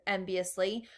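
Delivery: ordinary delivery, nothing marked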